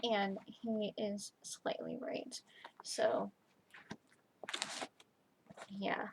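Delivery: slow, hushed whisper. Real, not acted. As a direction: admit it under your breath. Admitting fear, hushed.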